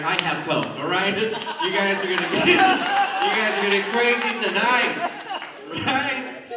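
hyped-up party guy pitch; mock dealer hype; acted quote. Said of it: "He's like".

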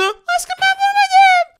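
put-on squeaky high-pitched spider girl, slow and sweet; girly high spider girl voice. (çok ince sesli örümcek kız)

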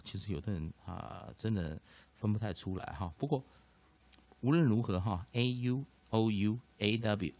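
normal talking voice

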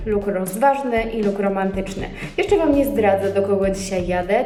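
usual speaking voice

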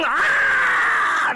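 high, loud scream of pain; pained monster scream. A narrator's reading. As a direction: shriek as the pellet hits. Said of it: "疼得妖怪大叫一声".

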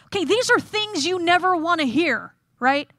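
ordinary delivery

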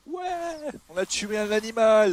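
high, loud victory shout; excited cheer. Real, not acted. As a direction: cheer the kill. Celebrating the kill.

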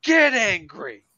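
high, slow, emphatic urging; egging-on pit boss. Retold or imitated. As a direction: stretch it out like a fired-up coach, imitated. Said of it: "It's like".

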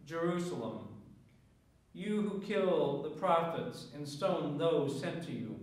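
ordinary talk

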